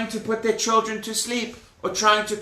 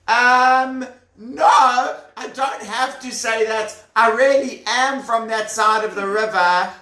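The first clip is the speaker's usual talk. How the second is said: higher, stalling protest; hesitate, then protest louder; nervous traveller; 5 semitones higher, 3 dB louder than usual. Acted. Traveller insists he is from that side, nervous protest.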